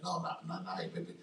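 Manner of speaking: soft, faint whisper to his wife; barely able to answer, drained; weak whisper. Retold or imitated. Man telling wife he's not all right, faint whisper.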